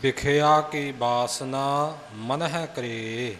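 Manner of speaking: very slow teaching reading, ਮਨ ਹੈ said firmly; slow, drawn-out demonstration of ਮਨ ਹੈ ਕਰੇ; correct pronunciation model. Shown as a demonstration. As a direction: stretch the line out, sounding ਮਨ ਹੈ clearly. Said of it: "ਪੋਲਾ ਜਿਹਾ ਨਹੀਂ ਬੋਲਣਾ".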